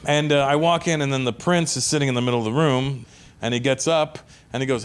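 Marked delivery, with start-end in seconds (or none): none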